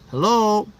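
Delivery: loud, high-pitched hello; mock calling out. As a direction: call out as if checking whether anyone can hear. Demonstrates saying hello in the head.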